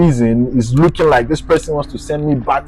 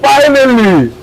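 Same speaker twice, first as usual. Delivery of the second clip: joyful relief; 12 semitones higher than usual. Real, not acted. Crying out to himself with his passport back, high and joyful. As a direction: shout it like heaven opened.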